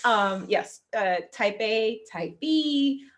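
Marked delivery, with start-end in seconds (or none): none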